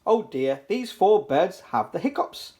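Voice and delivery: usual speaking voice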